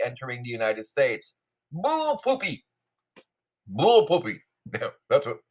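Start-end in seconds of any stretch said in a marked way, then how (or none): 1.7-2.6 pitched way up, a squeaky mocking "Bull poopy"; mock Texas exclamation. Mocking the press secretary's border claim, high and squeaky. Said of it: "that's what they say down in Texas". 3.7-4.4 "Bull poopy" said louder and firmer, with a blunt fall; firm scoffing retort. Retold or imitated. Dismissing the border figures with a firm scoffing retort. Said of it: "that's what they say down in Texas".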